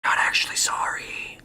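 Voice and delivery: sly whispered aside. Acted. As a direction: whisper it behind your hand.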